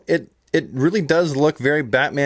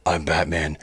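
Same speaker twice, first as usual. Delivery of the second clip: very low, soft superhero growl; Batman growl; 11 semitones lower, 4 dB quieter than usual. Acted. Fan showing how the figure growls to him, deep growl. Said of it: "this figure growls".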